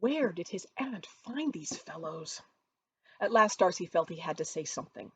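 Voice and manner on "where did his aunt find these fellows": ordinary delivery, nothing marked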